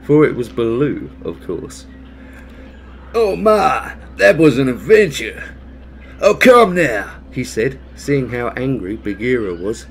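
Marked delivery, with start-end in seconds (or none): 3.1-7.2 loud, high, cheery bear; jolly bear voice. Acted. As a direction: jolly bear chuckling at the soaking. Amused bear after fall.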